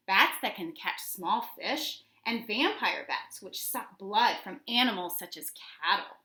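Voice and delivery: ordinary talk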